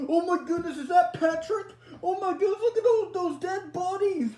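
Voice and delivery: very high, loud horrified cries; panicked shriek. Acted. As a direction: scream it, horror rising. Sees Patrick and the bodies.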